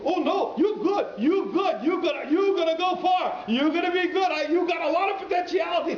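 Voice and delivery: high, loud, gushing cabbie voice; excited taxi driver. Retold or imitated. Taxi driver praising the boys, high and loud. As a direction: shout the praise like an overjoyed stranger. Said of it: "And he goes".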